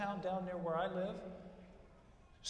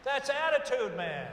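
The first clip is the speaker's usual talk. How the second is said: emphatic shout; 7 semitones higher, 11 dB louder than usual. Real, not acted. Punchline shouted to graduates.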